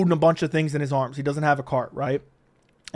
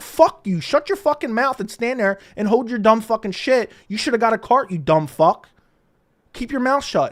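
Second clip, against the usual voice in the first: retold angry tirade, raised pitch; infuriated; 5 semitones higher than usual. Storyteller tells the man behind him to shut up, infuriated. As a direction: act out telling a stranger off. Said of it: "I got immediately infuriated".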